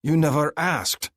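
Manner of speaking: slow, firm counter with YOU leaned on; irritated retort. A narrator's reading. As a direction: snap back, irritated. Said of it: "my irritation rising".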